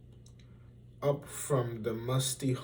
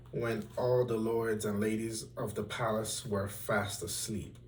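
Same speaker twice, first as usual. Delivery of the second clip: ordinary delivery, nothing marked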